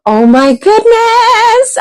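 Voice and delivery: gasp, then a slow high "oh my goodness"; excited gasp. Real, not acted. Host gasping as the prize wheel rolls in, high and slow. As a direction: stretch it out in high delight.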